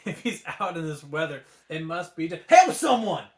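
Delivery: building to a loud shout. A narrator's reading. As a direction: shout the realisation. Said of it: "Mushu shouted".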